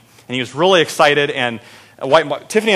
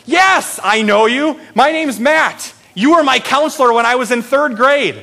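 much higher, louder, eager; excited recognition; 9 semitones higher, 3 dB louder than usual. Retold or imitated. Matt tells his old counselor he remembers him, excited and high. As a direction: burst out like a grown kid thrilled to be remembered.

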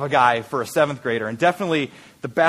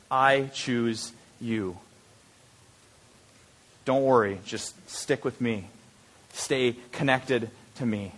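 softer, slow, gentle promise; tender reassurance; 5 semitones lower, 6 dB quieter, slower than usual. Acted. The preacher voicing Jesus to a struggling listener, soft tender reassurance. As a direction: say it softly, like a hand on a shoulder.

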